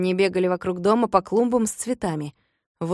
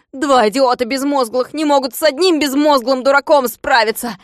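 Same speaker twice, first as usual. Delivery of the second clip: loud, higher furious scolding; furious yelling; 4 semitones higher, 5 dB louder than usual. Acted. Klops cursing his dogs, furious yelling. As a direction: rage at the stupid dogs.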